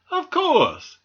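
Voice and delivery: high, loud assurance; emphatic reassurance. A narrator's reading. Doctor answers anxious squirrel, emphatic reassurance. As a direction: assure them there is no doubt.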